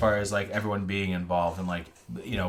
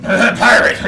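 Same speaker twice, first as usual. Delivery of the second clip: high, loud laughing pirate; mock pirate voice; 13 semitones higher, 16 dB louder than usual. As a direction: ham up a pirate through laughter. Laughing echo of friend's pirate voice.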